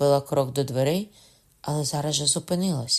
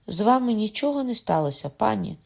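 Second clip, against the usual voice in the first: much higher and louder, anxious; anxious worry; 8 semitones higher, 4 dB louder than usual. A narrator's reading. The countess anxiously asks the queen if she is hurt. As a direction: ask in breathless worry.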